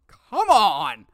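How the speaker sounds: loud, high groan of protest; exasperated. Real, not acted. Griping about the wait to his co-host, loud and exasperated. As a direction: throw up your hands with it.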